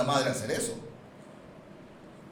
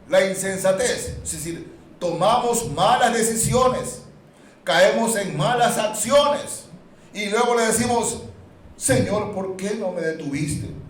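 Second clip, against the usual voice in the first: loud, high impassioned preaching; full-force preaching; 8 semitones higher, 9 dB louder than usual. Acted. Preacher mimicking complaining believers, loud and high. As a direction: drive it home to the congregation.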